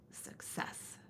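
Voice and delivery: breathy hushed whisper. A narrator's reading. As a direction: whisper it like a secret triumph. Quiet payoff after fire.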